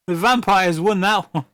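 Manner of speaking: ordinary talk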